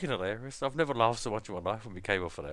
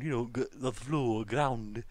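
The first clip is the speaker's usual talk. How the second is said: ordinary talk, nothing marked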